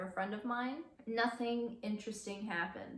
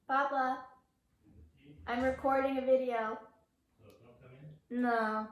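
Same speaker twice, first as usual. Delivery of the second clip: calling out; 3 semitones higher, 4 dB louder than usual. Real, not acted. Calling across house to father.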